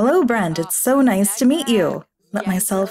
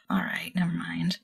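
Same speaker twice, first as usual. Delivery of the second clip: "All right, never mind" breathed out unvoiced, much softer; defeated whisper; 4 semitones lower, 11 dB quieter than usual. Real, not acted. Player gives up on the stubborn sim, whispered in defeat. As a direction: give up under your breath. Gives up after failed command.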